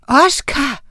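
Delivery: højt, overrasket kald; råb hans navn i højere tone. A narrator's reading. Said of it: "råbte Miranda". (forskrækket kalden)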